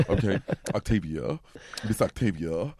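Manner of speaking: normal talking voice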